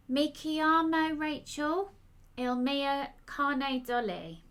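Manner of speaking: put-on slow Italian words in a London accent; clumsy Italian said with a London accent; awkward London twang. A narrator's reading. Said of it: "saying these Italian words with an awkward London twang".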